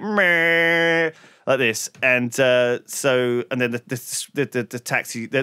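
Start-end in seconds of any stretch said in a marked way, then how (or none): 0.0-1.1 bus horn imitation. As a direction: blare it like the noisiest bus horn.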